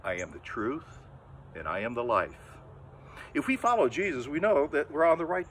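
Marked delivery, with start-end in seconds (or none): 0.0-2.4 low, measured, reverent; low and reverent, with a pause after each line; solemn quote. Acted. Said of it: "Jesus said".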